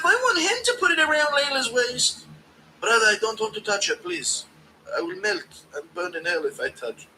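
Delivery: high, whiny mock Ian Gary; Gary impression. Streamer mocking Gary refusing to touch her, high whine. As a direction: plead in a high put-on voice. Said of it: "impression of Gary to Dana".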